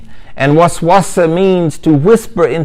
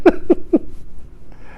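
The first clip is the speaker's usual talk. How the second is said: breathless high giggle; giddy high laugh; 6 dB quieter than usual. Acted. Dazzled buyer picturing the car, high breathless giggle. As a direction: giggle like a smitten customer.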